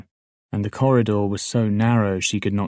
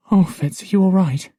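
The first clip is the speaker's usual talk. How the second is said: anxious call; 11 semitones higher, 7 dB louder than usual. Acted. Anxious friend calls to prisoner.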